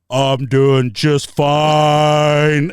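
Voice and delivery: very slow, drawn-out sultry purr; sultry reply. Retold or imitated. Woman answering teller in waiting room seductively. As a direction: stretch it out, seductive.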